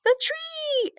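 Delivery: high, bright burst of surprise; excited exclaim. A narrator's reading. Henry spotting the tree on the car, excited. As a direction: shout it out at the sight of the tree. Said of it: "exclaimed Henry".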